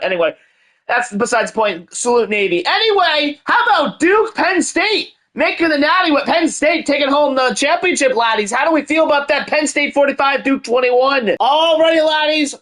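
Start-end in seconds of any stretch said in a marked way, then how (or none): 2.7-12.5 excited celebration. Acted. Hyped title celebration.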